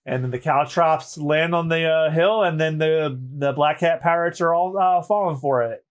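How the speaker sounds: usual speaking voice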